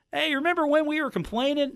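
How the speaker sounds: put-on high, creaky old man; cranky old-timer reminiscing. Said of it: "me in my old man voice".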